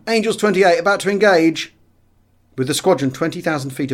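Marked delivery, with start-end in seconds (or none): 0.1-1.7 higher, louder pilot's reply; radio reply. A narrator's reading. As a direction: report it clipped over the radio. Section leader replies by radio.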